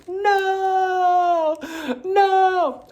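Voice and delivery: long, high, loud cry of dismay; hammed-up heartbroken wail; anguished wailing no. Reacting to news that Lamonte quit, hammed-up anguished wail.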